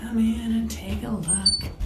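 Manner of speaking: ordinary delivery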